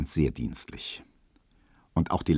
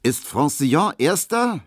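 high, loud eager question; raised-voice, urgent query; called out; 9 semitones higher, 5 dB louder than usual. A narrator's reading. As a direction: call the question across the room. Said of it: "rief er dann".

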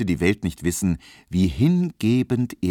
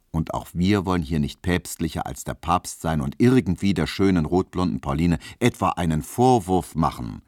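ordinary delivery

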